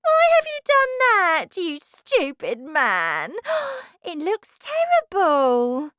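high, loud scolding with a gasp; furious wife. Acted. Wife scolding her husband over his hair, shrill. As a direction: berate him, appalled.

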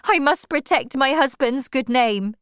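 high, loud, distressed woman; cried insistence. A narrator's reading. Wife protecting husband's name from suitor, urgent. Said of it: "she cried".

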